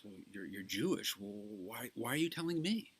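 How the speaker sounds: priest baffled, his pitch jumping up on the last question; puzzled priest. Retold or imitated. Joke, priest replies startled.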